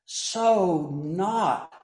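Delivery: slow emphatic. Real, not acted.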